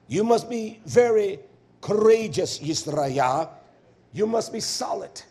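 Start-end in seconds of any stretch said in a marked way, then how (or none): none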